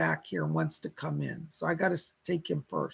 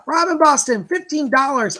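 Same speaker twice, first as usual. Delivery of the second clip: louder, higher delighted announcement; delighted surprise; 6 semitones higher, 7 dB louder than usual. Real, not acted. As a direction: call out the donor with raised excitement.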